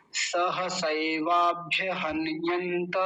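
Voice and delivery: सह सैवाभ्य हन्यन्त joined slowly, each syllable clear; slow Sanskrit recitation. Shown as a demonstration. Teacher joining four words for students, slow and clear. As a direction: recite the phrase slowly for learners. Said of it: "अब चारों को मिलाएंगे".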